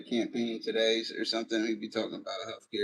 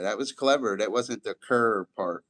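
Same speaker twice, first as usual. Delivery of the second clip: usual speaking voice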